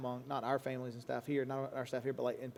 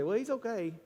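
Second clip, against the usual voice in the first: mock casual reply; 7 semitones higher than usual. Acted answer about athlete.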